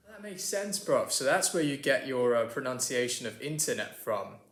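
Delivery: British cousin catching on, T kept in internet; chummy British 'bruv' talk; British accent. Acted. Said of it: "my British cousin here".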